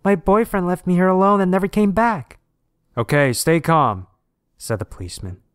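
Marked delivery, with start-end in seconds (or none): 0.0-2.4 put-on high, loud distressed girlfriend; loud, high-pitched plea; crying out. A narrator's reading. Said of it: "she cried". 3.0-4.1 loud, slow, raised command; raised-voice officer giving orders; policeman calling out. A narrator's reading. Policeman calling to stranded girl.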